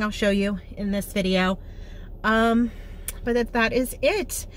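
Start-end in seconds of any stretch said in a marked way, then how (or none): none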